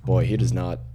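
ordinary talk, nothing marked

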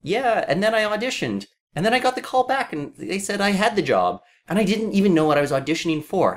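high-pitched imitation of a rote panel answer; mock actor anecdote. Fan imitating actors' answers about getting the job, mock anecdote.

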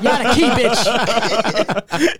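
slow mock yell. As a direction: drag each word out to insult him. Inmate yelling at singer.

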